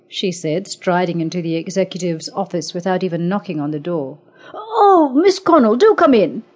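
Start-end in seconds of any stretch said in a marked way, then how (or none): 4.5-6.4 high, slightly louder, anxious greeting; a frightened man forcing politeness; nervous welcome. A narrator's reading. Executive nervously greeting the feared assessor. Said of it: "struggled weakly against his fear".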